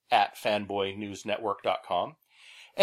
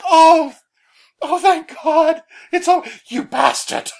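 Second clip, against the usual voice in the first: a very high, loud mock cry of relief; bad Pete impression; 11 dB louder, slower than usual. Pete seeing daylight at the ride's end, a loud, high mock relief. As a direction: shriek with hammed-up relief, then curse. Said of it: "my bad Pete impersonation".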